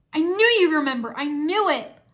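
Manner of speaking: high, loud, elated exclamation; crying out. A narrator's reading. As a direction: cry out in relieved joy. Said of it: "Lloyd cried".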